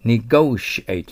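Negotiate said slowly, stress on GO, ending with a plain t; teacher models Negotiate, slow and careful; pronounced negotiate. Shown as a demonstration. Teacher models the word for students, slow careful pronunciation. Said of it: "と発音します".

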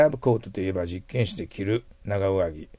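ordinary talk, nothing marked

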